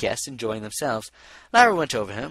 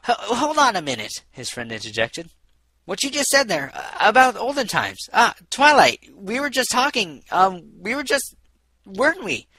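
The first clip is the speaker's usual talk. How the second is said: high, louder fumbling; flustered stalling; 8 semitones higher, 8 dB louder than usual. Acted. Lyra stalling to save the conversation, high and flustered. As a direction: stall for time, tripping over words.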